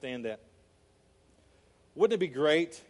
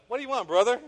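higher, louder mock yell at the door; retold yell; 7 semitones higher, 7 dB louder, faster than usual. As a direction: snap at the brother knocking. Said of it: "He probably yelled at him".

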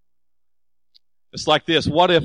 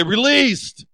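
"Released" called out high and louder; 9 semitones higher, 3 dB louder than usual. Shown as a demonstration. Pastor leading the congregation's response, "Released" called out. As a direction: lift "Released" up for the crowd to echo. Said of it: "Come on, say it".

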